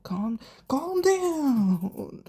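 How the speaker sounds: high, very slow stretched calm; a half-laughing, slow imitation of a guy calming someone down; mock soothing drawl. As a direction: drag out each calm like you're soothing a hothead. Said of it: "He was like".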